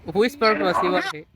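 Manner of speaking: ordinary delivery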